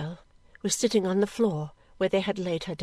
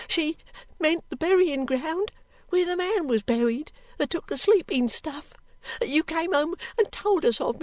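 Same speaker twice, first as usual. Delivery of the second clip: put-on high, tearful panicking servant girl; frightened cry; 9 semitones higher, 3 dB louder than usual. A narrator's reading. Girl clinging to Missus Snagsby, terrified. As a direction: wail it out, begging for comfort. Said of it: "cried the girl".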